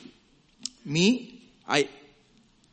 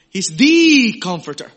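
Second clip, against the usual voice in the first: He's the comforter shouted out, much louder; preaching at full force; loud proclamation; 3 semitones higher, 11 dB louder than usual. Shown as a demonstration. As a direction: boom He's the comforter to the whole church.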